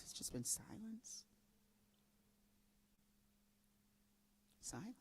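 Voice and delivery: hushed whisper, almost no voice. Real, not acted. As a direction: breathe the words out as a whisper. Describing the hush after her concerts.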